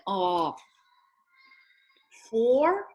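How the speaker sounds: normal talking voice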